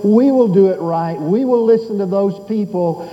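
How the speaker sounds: rising, fervent sermon appeal; preaching. Acted. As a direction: press the congregation, building. Preaching at full force.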